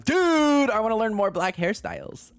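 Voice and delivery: high, loud, bursting with enthusiasm; excited outburst. Real, not acted. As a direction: blurt it out in a high excited yell. Sudden excited outburst about hairstyles.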